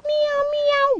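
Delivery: very high squeaky meow; high cat meow. Pretend. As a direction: squeak it like a kitten. Toy cat voice.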